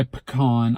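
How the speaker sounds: pecan as puh-KAHN; rejected pronunciation. Shown as a demonstration. Speaker showing what they don't say, pecan as puh-KAHN. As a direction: say pecan with a weak first syllable, "puh-KAHN". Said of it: "I don't say pecan".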